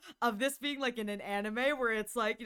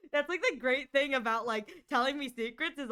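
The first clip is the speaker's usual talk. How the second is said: ordinary delivery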